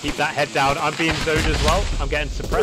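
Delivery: loud, tense combat callout; urgent shout. Real, not acted. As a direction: shout over the gunfire. Pinned down, warning teammate.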